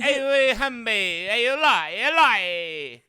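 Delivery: loud, high mock llama calls; llama impression. Streamer kicking off a llama impression contest, loud hammed-up llama. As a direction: belt it out as a daft llama. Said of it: "Llama impression coming in hot".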